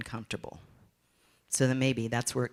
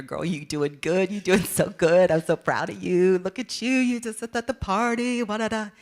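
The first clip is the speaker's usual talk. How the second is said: high, loud, sassy self cheerleading; self hype; 7 semitones higher, 5 dB louder than usual. Retold or imitated. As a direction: pump yourself up like your own best friend. Cheering herself on in bathroom.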